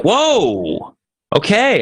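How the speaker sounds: high, surprised whoa okay; a sudden high-pitched double take; startled whoa. Retold or imitated. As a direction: jump up high in mock surprise. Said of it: "And I'm like".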